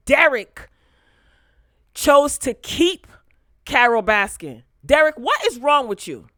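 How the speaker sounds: yelling. Real, not acted. Vlogger ranting at Derek over the elimination, yelling. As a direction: yell at Derek through the mic. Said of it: "I'm probably yelling in the mic".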